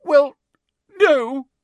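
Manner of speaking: high, loud tearful wail; hammed-up crying girl; sobbing. A narrator's reading. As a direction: sob out each word separately. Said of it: "sobbed Hermione".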